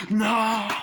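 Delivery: high, loud mock-crazed yell; hammed-up hysterical shout; freaking out. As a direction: act out a raving doctor. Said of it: "the doctor starts freaking the fuck out".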